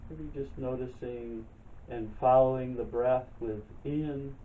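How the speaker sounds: calm, unhurried meditation guide, long pauses between phrases; guided meditation. Real, not acted. Leads breathing and chair yoga.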